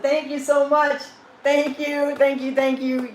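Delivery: high, loud, gushing thank-yous; excited thanks. Real, not acted. Creator thanking viewers for reaching a thousand, gushing. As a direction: thank the fans with delight.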